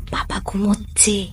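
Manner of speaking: soft breathy whisper. Real, not acted. Mother whispering that dad is sleeping. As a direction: whisper so dad stays asleep.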